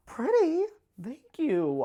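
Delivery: high, loud squeal of surprised delight. Retold or imitated. Answering the cashier's compliment with a high, loud, delighted squeal. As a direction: yelp Pretty in flattered shock. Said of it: "And I was like".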